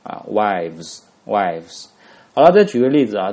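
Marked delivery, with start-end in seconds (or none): 0.3-1.9 wives said slowly and carefully, twice; drawn-out English "wives"; teacher modelling "wives" slowly; model pronunciation. Shown as a demonstration. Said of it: "W I V E S这样子".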